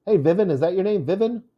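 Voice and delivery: quick, high "Vivin" as VIV-in; imitated greeting, high and fast, "Vivin" as VIV-in; first-syllable stress on name. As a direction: call out "Vivin" with the stress up front, VIV-in, rising question. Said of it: "And I called her".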